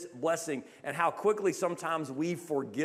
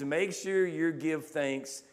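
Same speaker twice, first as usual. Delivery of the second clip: slow solemn quote; 2 semitones lower, much slower than usual. Retold or imitated. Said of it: "And he says".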